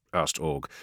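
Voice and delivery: ordinary delivery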